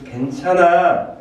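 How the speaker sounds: high, loud, drawn-out comfort; emphatic reassurance. Acted. God telling a failed child it is fine, emphatic drawn-out reassurance. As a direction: stretch it out as a heartfelt reassurance.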